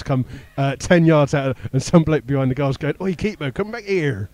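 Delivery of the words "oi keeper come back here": softened mock heckle in Somerset accent. Bloke behind the goal heckling the keeper, Somerset accent. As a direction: imitate a Somerset fan calling out, kept quiet. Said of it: "That's my best Somerset accent".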